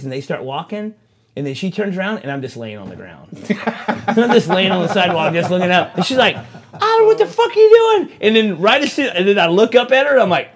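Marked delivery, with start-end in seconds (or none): 6.3-8.2 very high, loud screech; mock outraged girlfriend. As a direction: imitate a girlfriend yelling in outrage. Girlfriend yells at him on ground.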